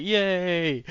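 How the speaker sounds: high, drawn-out kiddie cheer; excited kid. Retold or imitated. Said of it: "stereotypical when a kid's excited thing".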